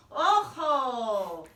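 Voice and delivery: very loud alien holler. Acted. Martians hollering back at the dog, loud. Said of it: "the Martians hollered".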